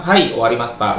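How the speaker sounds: "Hai, owarimashita" answered low and falling; reply "owarimashita" as a statement, pitch coming down; "owarimashita" with a settled falling end; low answering intonation. Shown as a demonstration. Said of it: "kamu jawabnya rendah gitu".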